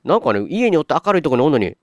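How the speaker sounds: ordinary talk, nothing marked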